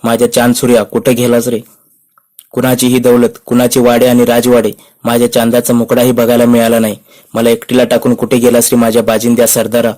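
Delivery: plain reading voice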